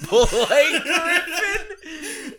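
high, drawn-out laughing disbelief; incredulous laughing. Real, not acted. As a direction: stretch it out as a real laugh breaks through. Incredulous laugh at absurd casting.

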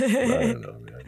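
mock voice. Playful voiced shrug.

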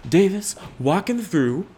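high, long drawn-out hype call; announcer call. Pretend. Streamer heralding Davis onto the podcast, drawn-out announcer call. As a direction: stretch it out like a mock announcer.

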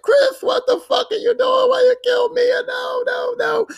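hammed-up high-pitched screaming outrage; irate. Ethan confronting Chris over killing Mia, mock irate screaming. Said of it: "Ethan's irate".

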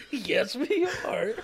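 after laughing hard. Real, not acted. Still laughing, insisting.

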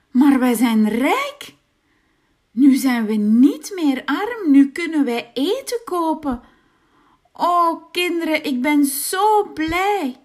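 a poor man suddenly rich and thrilled; happy woodcutter. A narrator's reading. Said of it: "zei de houthakker". (blije houthakker, luider)

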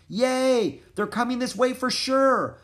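high, loud cheer; excited shout. A narrator's reading. Annie celebrating the nearing team, excited shout. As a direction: cheer over the storm. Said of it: "shouted Annie".

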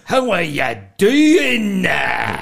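very slow, loud, high hammed-up greeting through laughter; mock comedian greeting, laughing. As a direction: stretch every word, laughing at the cliché. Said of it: "comedians go up to you and go".

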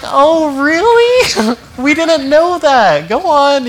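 very high, loud, mocking laugh; mock the excuse with fake amazement; sarcastic. Pastor sarcastically answering someone who says no harm intended. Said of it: "It's like".

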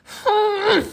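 very loud, high, drawn-out "hmph"; big cartoon huff of exasperation; exasperated harrumph. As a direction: harrumph at full volume, hammed up. Grumpy panda harrumphs in story.

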